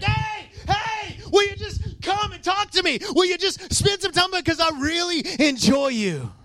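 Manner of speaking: high, pleading voice of God; God calling out. Pretend. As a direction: call out warmly, longing to be heard. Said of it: "God's like".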